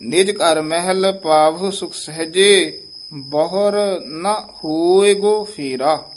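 slow, with ਹੋਏਗੋ set apart; slowly, marking ਹੋਏਗੋ; slow model recitation. Shown as a demonstration. Teacher recites the full line for the student. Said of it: "ਗੋ ਤੋਂ dash".

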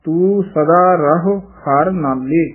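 slow, with a short quick ਰਹੋ; ਰਹੋ clipped short in a slow line; slow model line. Shown as a demonstration. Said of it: "ਛੇਤੀ ਬੋਲਣਾ ਹੈ". (ਰਹੋ ਛੇਤੀ)

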